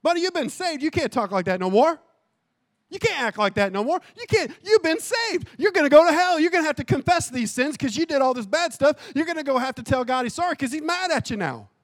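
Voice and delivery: high, loud church busybody telling someone off; mock judgmental scolding. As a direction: scold a new convert with hammed-up alarm.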